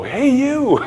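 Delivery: mock team response to a visitor; group calling back. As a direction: call back cheerfully as a crowd. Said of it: "And they'll go".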